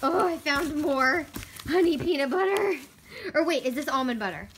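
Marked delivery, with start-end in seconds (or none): none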